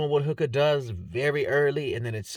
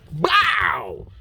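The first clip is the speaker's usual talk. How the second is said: elbow impact; 4 semitones higher, 4 dB louder than usual. Retold or imitated. Mimicking the elbow strike.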